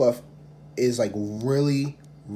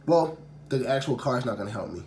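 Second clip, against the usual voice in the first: ordinary talk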